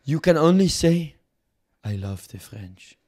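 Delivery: emphatic mock French decree, softening at the end; put-on French accent. French envoy banning insults to France, French accent. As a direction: forbid it as a hammy French negotiator. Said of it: "Fuck this French accent".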